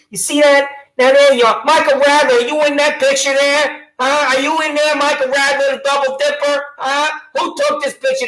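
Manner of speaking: mocking taunt. Real, not acted. Ranting citizen taunts deputy Michael Radler in a high mocking call.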